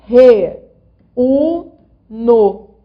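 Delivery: "Re, u, no" split into loud, separate syllables. Shown as a demonstration. Teacher spelling out "Re, u, no" syllable by syllable for the class. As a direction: break "Re, u, no" into three punched beats. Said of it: "Agora é o U".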